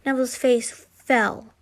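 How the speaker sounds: usual speaking voice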